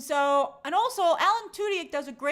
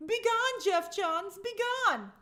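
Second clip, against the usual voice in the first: mock banishing; 8 semitones higher than usual. Mock banishing comic writer.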